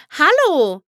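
bright, loud hello called out; cheerful call. A narrator's reading. Twilight greets the startled pony with a cheerful hello. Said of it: "rief Twilight fröhlich".

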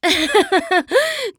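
high, loud showy laugh; a proud stage laugh; Trixie's laugh. Acted. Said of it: "spuckte ... aus und lachte". (hohes, lautes Lachen)

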